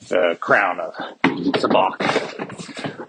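slow, grand movie-trailer delivery of the title; mock epic. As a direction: drag out "the crown of Sabacc" like a legend. The crown he's destined to wear.

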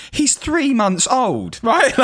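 high-pitched, drawn-out mock indignation; indignant retort. Retelling his retort to a friend about baby, mock indignant. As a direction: protest up high, stretching the words.

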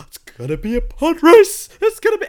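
high, loud, thrilled squeal; giddy excitement. Retold or imitated. To his co-host, retelling hoping for pod racing, high thrilled squeal. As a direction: squeal it out like a kid who can't wait.

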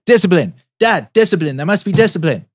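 put-on high, loud kid's command; little son lecturing dad on discipline, high and loud; son shouting. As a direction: bark it like a small drill sergeant.

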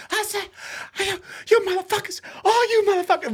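very high, strained shouting at players; high-pitched yelling coach imitation; imitating a coach's hoarse squeal. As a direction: squeal it like a coach who lost his voice. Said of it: "even higher pitched than that".

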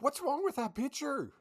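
high-pitched retold bafflement; incredulous self-quote. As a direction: voice your baffled reaction way up high. Retells own reaction to a photo.

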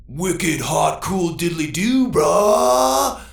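slow build to a high, loud whoop; excited shout. A narrator's reading. Robot and owner trading slang, excited shout building high. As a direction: punch each word, then yell the last. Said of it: "exchanged excited shouts".

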